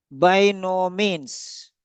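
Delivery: "by no means" drawn out very slowly; slow, deliberate presentation. Shown as a demonstration. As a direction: present the idiom slowly, stretching each word. Teacher presents the idiom slowly.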